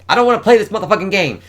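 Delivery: high, loud and fast rant; fed-up outburst. Acted. She refuses to play along, a high, fast, loud outburst. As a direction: blurt it out in exasperation.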